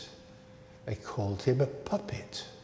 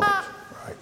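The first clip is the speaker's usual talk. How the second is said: parrot puppet squeak. Pretend.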